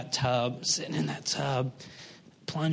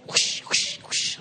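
breathy whooshing water noise; whispered whoosh; much slower than usual. Retold or imitated. Pastor mimicking water gushing through the drain. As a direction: push air out like rushing water.

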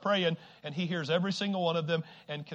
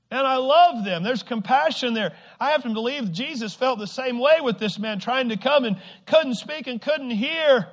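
loud, raised sermon voice; forceful preaching; 8 semitones higher, 11 dB louder than usual. Real, not acted. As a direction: press the point home to the congregation.

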